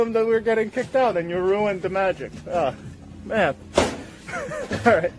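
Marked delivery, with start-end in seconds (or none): none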